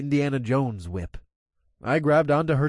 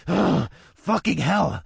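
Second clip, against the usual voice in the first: slow, frustrated growl; 6 semitones higher, 3 dB louder, slower than usual. Acted. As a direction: growl it in exasperation. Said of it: "I growled".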